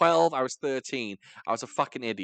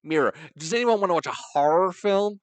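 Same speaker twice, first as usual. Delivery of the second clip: louder, higher imitation of an American; mock American accent; 7 semitones higher, 6 dB louder than usual. Teasing an American friend, mock American accent. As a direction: exaggerate the American R's, raised and loud. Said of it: "that's how you sound".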